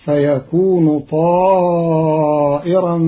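طائرا with a long ā and a hamza, ṭāʾiran; Qalun reading. Shown as a demonstration. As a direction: stretch طائرا, slow chant. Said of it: "فتصير".

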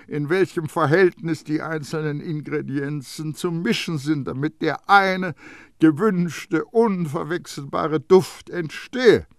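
high, loud schoolmasterly perfumer; Baldini lecturing. A narrator's reading. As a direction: explain it like to a dunce. Baldini explains the formula.

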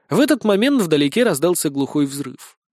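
ordinary delivery

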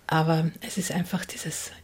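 soft unvoiced whisper. Real, not acted. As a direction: drop to a whisper, wistful. Flüstert vor dem Zitat. (leises Flüstern)

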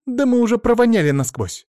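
high, loud wail of protest; outcry. A narrator's reading. Pupils complain about the stinking classroom, crying out. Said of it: "Раздались гвалт и крики".

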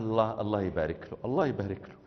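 quiet low Egyptian blessing. Retold or imitated. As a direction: softly bless him Egyptian style. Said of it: "أخ مصري".

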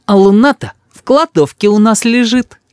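put-on high child voice letting a secret slip; boy spilling secret. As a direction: blurt it out like a little boy. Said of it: "Рис помучился минут пять и сказал".

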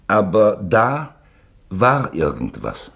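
drawn out, searching for words; slow down as if the memory unsettles you; slow, hesitant. Acted. Uncle hesitantly recalling a strange article for his nephew.

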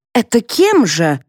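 mild indignant challenge, slowed; flaring up. A narrator's reading. Mother challenging the family decision, flaring up. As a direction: demand it with a flicker of anger. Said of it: "вспыхнула Флора".

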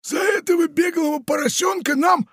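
very high, shouted triumphant announcement; solemn pirate. A narrator's reading. Pirate triumphantly announces the reward to the parrot. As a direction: proclaim it grandly. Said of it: "торжественно сообщил он".

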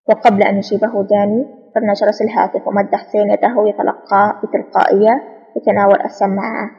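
ordinary delivery

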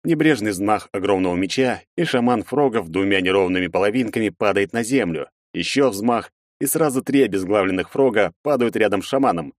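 ordinary delivery, nothing marked

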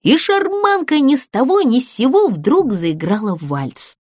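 ordinary delivery, nothing marked

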